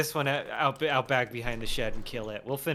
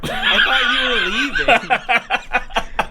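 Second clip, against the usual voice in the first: laughing; 11 semitones higher, 8 dB louder, much slower than usual. Acted. Reading a chat taunt, laughing.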